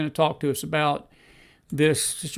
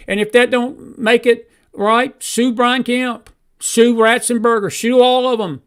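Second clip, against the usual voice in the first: higher and louder list of targets; escalating shout; 6 semitones higher, 5 dB louder than usual. Real, not acted. Host to viewers, escalating louder demand. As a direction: ramp up each name. Straw poll rant escalating.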